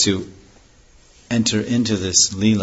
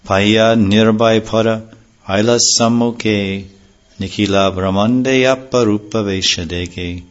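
slow, louder chanted recitation of sacred verse; 4 dB louder than usual. Acted. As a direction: intone each line slowly and fully. Reciting Bengali scripture verses.

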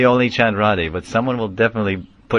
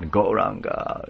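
normal talking voice

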